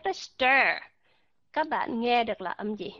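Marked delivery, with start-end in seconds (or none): 0.1-0.8 "stir" with a full, stressed er; clear ER. Shown as a demonstration. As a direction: give "stir" a distinct er, not a schwa. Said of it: "rõ ràng phải không".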